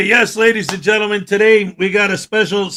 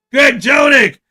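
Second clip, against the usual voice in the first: stretched-out, punchy milestone announcement; hype announcer; 4 semitones higher than usual. Acted. Host celebrating a member's months of fun, hype announcer. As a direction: draw out the big words like an announcer.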